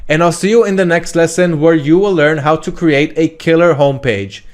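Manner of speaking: usual speaking voice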